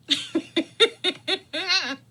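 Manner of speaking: loud high burst of laughter; big laugh. Real, not acted. Laughing at her memory.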